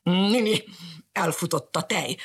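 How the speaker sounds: mildly grumbling dog. Acted. Dog grumbling about spilt milk. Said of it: "morgott a bodri".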